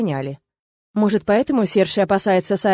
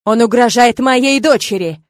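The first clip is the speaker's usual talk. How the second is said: loud protest; 2 semitones higher, 7 dB louder than usual. Acted. Father protests in alarm.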